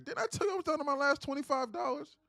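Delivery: loud, high-pitched comic complaint; hammed-up irate customer, voice pushed high and loud; switched-up angry caller voice. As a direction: rant as a desperate losing viewer. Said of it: "I switch up the voice, and I go".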